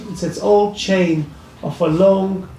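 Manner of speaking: ordinary talk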